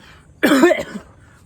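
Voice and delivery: a big loud cough, pitched high; loud mock cough from the back of the store; imitated cough. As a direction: cough hard, like someone about to die. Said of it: "like, coughing in the back".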